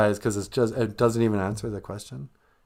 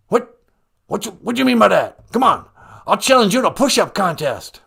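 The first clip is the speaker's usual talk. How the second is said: indignant, loud, higher-pitched challenge; Joe Biden; 7 semitones higher, 6 dB louder than usual. Acted. President bristling at question about memory, indignant. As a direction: bluster back, affronted. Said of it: "Mister President".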